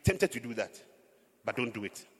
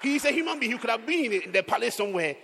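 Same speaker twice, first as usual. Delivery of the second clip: fervent preaching; 8 semitones higher, 7 dB louder than usual. Real, not acted. Preacher pressing the point.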